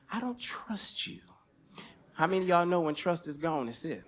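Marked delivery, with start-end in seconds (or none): 0.1-1.2 slow, breathy whisper. Retold or imitated. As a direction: whisper it slowly and coldly. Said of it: "I said".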